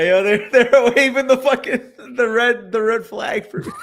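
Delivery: high, loud, laughing through the words; laughing hard. Real, not acted. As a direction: barely get it out through laughter. Laughing over a red flag joke.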